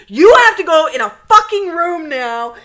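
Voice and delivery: high, loud re-enacted order; shouted ultimatum from a retold fight; exasperated yell. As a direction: yell it down the phone, fed up. Retold phone quarrel, ordering friend.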